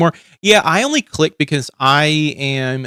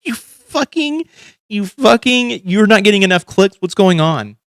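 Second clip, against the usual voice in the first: exasperated rant; 8 semitones higher, 4 dB louder than usual. Real, not acted. Ranting at a YouTuber.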